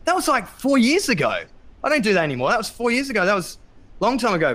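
high, fed-up protest; exasperated wife. Retold or imitated. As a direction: play the wife pushing back, worn out. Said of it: "She's like".

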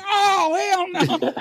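very high comic protest; imitated officer's high-pitched refusal; retold outraged refusal. Voices officer seeing the citations.

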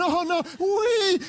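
high, slow mock squeal; fright turning to glee. Preacher acting out fear and thrill together, high slow squeal. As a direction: draw out the fear into delight. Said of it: "There's this sense of".

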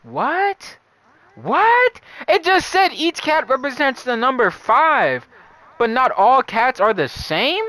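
baffled, voice jumping up; surprised. Real, not acted. Confused by the riddle's wording.

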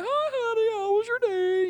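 very high, singsong mom greeting; hammed-up falsetto mother; mom impression. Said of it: "that's how your mom sounds".

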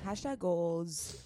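slow, dreamy echo; drawn out. Real, not acted. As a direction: savor it admiringly. Echoing admiring catchphrase.

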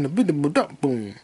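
usual speaking voice

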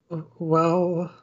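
a timid maid caught out, sorry and panicking; flustered clumsy maid. Acted. Maid Felicia apologises to butler Jacob and then drops the snacks, in a high flustered voice.

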